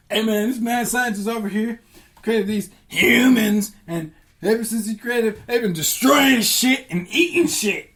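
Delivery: high, loud, indignant complaint; complaining gods. Acted. Gods complaining about the humans, high and indignant. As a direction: whine it up to the council. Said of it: "and they was like".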